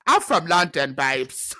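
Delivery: hammed-up London accent. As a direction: brag in a mock London accent. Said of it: "fooled an English person before".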